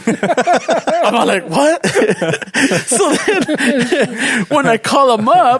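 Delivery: slow words broken by laughter; can barely get it out for laughing; laughing while telling. Real, not acted. Laughing through the retelling.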